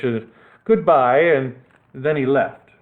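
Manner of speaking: ordinary delivery, nothing marked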